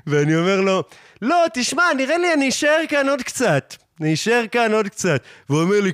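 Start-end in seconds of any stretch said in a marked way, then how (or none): 1.2-5.2 high-pitched, teasing retort; mock calling back. Answering the hipster at the crossing, teasing high call. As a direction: pitch it way up and quick, taunting the cyclist.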